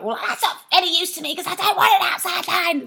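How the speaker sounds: high, loud exasperated shout; retold shouting. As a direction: yell at the useless phone. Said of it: "So I'm shouting all of this out".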